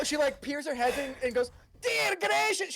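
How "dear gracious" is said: high, squealed dismay; shocked exclamation. Acted. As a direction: exclaim it like a scandalized halfling. Halfling peers into the dirty bath.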